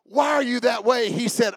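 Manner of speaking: ordinary talk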